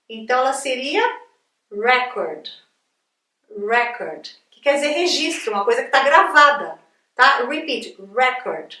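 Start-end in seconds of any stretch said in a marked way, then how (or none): 1.7-4.3 "record" as REC-ord, first syllable strong; stress on first syllable. Shown as a demonstration. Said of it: "a sílaba mais forte é essa daqui". 8.1-8.7 noun "record", stressed REC-ord; stress on first syllable. Shown as a demonstration. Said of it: "a sílaba mais forte é essa daqui".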